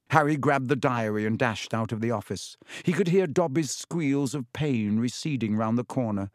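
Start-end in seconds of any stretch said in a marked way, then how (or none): none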